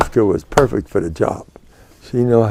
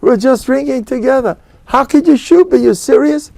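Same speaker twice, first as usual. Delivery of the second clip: much higher, pleading disbelief; pleading friend; 13 semitones higher than usual. Retold or imitated. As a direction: plead with your old drinking buddy. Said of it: "And the guy says".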